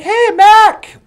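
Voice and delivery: shouted hail, acted; calling out. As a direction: holler to a worker over the noise. Calling to worker over jackhammer.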